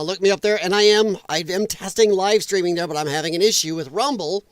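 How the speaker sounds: ordinary delivery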